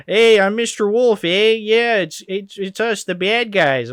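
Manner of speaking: high, loud mock Canadian voice; Canadian accent. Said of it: "so obviously this Canadian guy".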